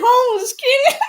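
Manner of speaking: laughing so hard it nearly cries; quote through laughter. Retold or imitated. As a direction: near tears from laughing. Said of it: "She said".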